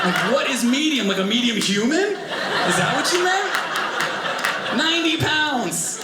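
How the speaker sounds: high, incredulous rant; comic outrage. Acted. Outraged at the puppy's size.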